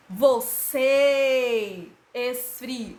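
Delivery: "Você esfriou" chanted slowly, CÊ and OU held long; loud, slow demo of "Você esfriou", last syllables drawn out; stress on last syllables. Shown as a demonstration. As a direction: stretch the final "cê" of "Você" and "ou" of "esfriou". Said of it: "As sílabas mais fortes são as últimas".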